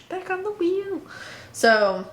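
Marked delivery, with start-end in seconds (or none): 0.1-1.0 sing-song echo. Pretend. Echoes line in sing-song.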